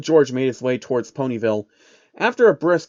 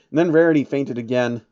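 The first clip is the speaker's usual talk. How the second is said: ordinary delivery, nothing marked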